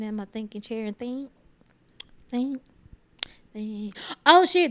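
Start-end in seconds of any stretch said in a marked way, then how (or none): 4.2-4.7 oh shit, a jump up in pitch and volume; sudden realization. Real, not acted. Remembering the Blue's Clues movie, surprised. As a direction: blurt it out as it hits you.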